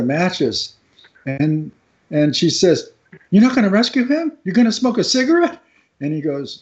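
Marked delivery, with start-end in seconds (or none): 3.3-5.6 higher, fast mock-indignant questions; incredulous questioning. Wife sees lifeguard light up, imitated fast incredulous questions. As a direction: rattle it off as the disbelieving wife.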